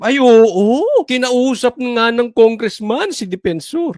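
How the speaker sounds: playing the easily-led public, slow and loud; gullible public. Voicing the public who swallow the media hint, mock-believing. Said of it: "para yung tao mag-iisip".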